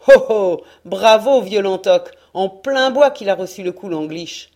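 loud, jubilant shout of a sailor; gleeful cheer. Acted. Old sailor cheering Lantoc's shot, loud and jubilant. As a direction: cheer the gunner on at full voice.

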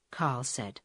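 ordinary delivery, nothing marked

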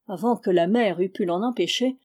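usual speaking voice

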